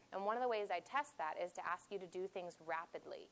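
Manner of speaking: ordinary delivery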